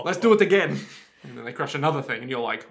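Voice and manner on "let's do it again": gleeful mock Austrian host, laughing; eager Austrian presenter voice; Austrian accent. Austrian host keen to crush another thing, gleeful accent. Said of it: "I sound like this with this accent".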